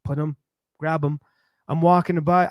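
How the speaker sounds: usual speaking voice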